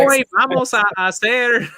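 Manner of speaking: put-on high, laughing Texas-accented Spanish. Coach teaching class in a joking Texas accent, laughing. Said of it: "in a Texas accent just to joke around".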